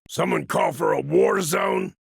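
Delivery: normal talking voice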